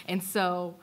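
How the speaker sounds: usual speaking voice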